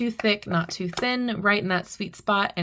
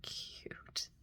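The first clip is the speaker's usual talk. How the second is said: breathy whispered aside; barely voiced, just air; 20 dB quieter than usual. Real, not acted. Admiring cards, whispering softly.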